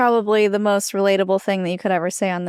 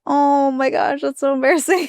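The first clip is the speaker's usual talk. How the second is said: cringing at herself; 5 semitones higher than usual. Retold or imitated. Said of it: "and I'm like".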